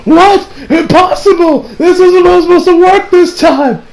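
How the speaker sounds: high, loud disbelieving yell; a plan collapsing, screamed high; shocked outcry. Retold or imitated. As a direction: shout in stunned disbelief. Hayato shocked attack fails.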